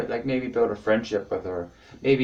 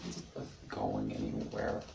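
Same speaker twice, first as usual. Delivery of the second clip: very low, soft mumble; gloomy mumble; 12 semitones lower, 13 dB quieter than usual. Pretend. Repeating his stand-up refrain, gloomy low mumble. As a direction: sink low and quiet in defeat.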